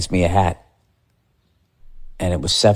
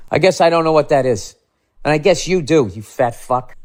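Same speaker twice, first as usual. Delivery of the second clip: sarcastic; 7 semitones higher than usual. Pretend. Sarcastic rant at clerk.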